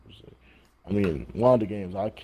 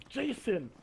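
higher called-out "Jason" like a stern mom; mom calling name; 11 semitones higher, 4 dB quieter than usual. Retold or imitated. Retelling the mom calling her son, called out. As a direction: call his name like an annoyed mother. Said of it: "all I heard was".

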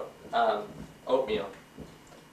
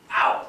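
yelp of pain; 5 dB louder than usual. Real, not acted. Burned on toaster.

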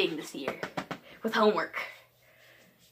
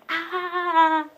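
usual speaking voice

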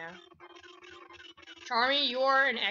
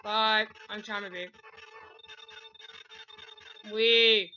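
ordinary delivery

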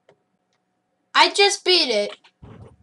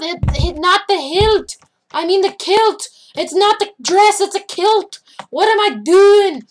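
high, loud hammed-up exasperation; loud mock rant; 6 semitones higher, 7 dB louder than usual. Gamer scolding himself and his sword-fighter, loud mock rant. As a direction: rant at full volume, pitched well up.

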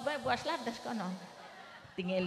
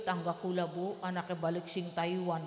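ordinary delivery

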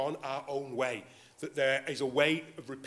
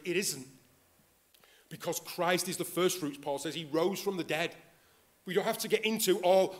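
ordinary delivery

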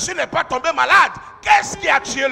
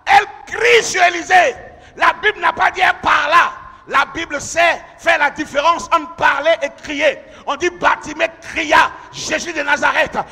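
louder, rising preacher's cry; full-force preaching; 3 semitones higher, 6 dB louder than usual. Real, not acted. Preacher rousing the congregation, loud and rising. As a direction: drive it home to the church.

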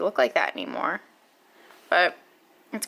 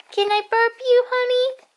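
much higher, louder coo; 7 dB louder than usual. Real, not acted. Mother coos again to her baby, higher. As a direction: push it way up into sing-song. Same words said twice.

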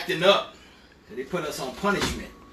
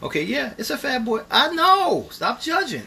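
excited; 10 semitones higher, 8 dB louder than usual. Real, not acted. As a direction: gush over the plate, playfully defensive. Excited over big plate.

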